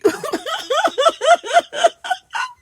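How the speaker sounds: cracking up; high helpless laugh. Real, not acted. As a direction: burst of high giggling. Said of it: "I'm laughing".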